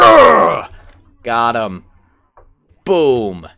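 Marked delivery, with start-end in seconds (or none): 0.0-0.7 high, loud "ugh" of effort; effortful gamer roar; loud straining growl. Real, not acted. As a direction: growl out the exertion. Straining to catch the enemy.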